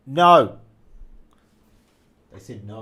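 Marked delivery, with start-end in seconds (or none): 0.1-0.6 loud, high yelled answer; shouted no. Retold or imitated. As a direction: bark the no loudly. Shouted answer in acted scene.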